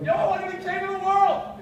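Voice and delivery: playful over-the-top yell of triumph; mock yell. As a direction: belt it out like a cocky kid claiming the throne. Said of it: "yell and go".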